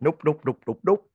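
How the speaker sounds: rising, loud panicked nope nope. Retold or imitated. Scared of the Shining scene, loud panicked refusal. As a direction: back out of the scary scene loud.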